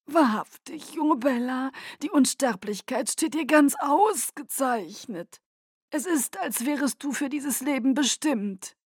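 courtly Aro. Acted. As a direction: flatter her with lofty charm. Aro's voice held.

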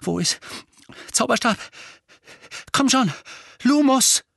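high, loud desperate calling; frantic. A narrator's reading. Harry, searching frantically for his wand, casts a spell. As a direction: search in a frenzy.

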